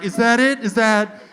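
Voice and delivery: high, loud flustered questions; frantic, eager-to-comply questioning; nervous. Retold or imitated. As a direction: imitate panicking while handing over papers. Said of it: "I get nervous".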